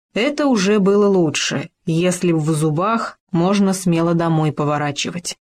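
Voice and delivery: usual speaking voice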